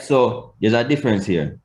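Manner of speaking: ordinary talk, nothing marked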